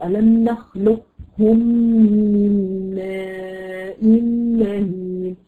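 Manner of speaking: very slow recited ألم نخلقكم من ماء مهين; Quran recitation. Shown as a demonstration. Teacher recites the Mursalat verse to her students. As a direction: chant the verse slowly with full tajweed. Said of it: "الله عز وجل يقول".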